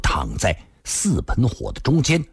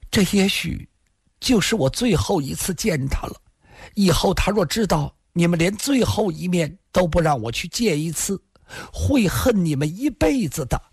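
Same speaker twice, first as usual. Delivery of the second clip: wistful woman's voice; 7 semitones higher than usual. A narrator's reading. Lin's last plea to see him, wistful. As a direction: plead in a lifted, wistful woman's voice. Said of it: "林仙儿悠悠地说道".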